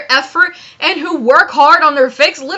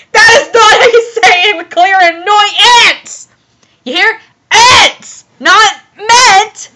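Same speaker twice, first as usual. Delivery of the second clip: raised, high-pitched exasperated yell; exasperated correction; 5 semitones higher, 3 dB louder than usual. Real, not acted. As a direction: correct it in a loud, pained cry. Loudly corrects the author's word.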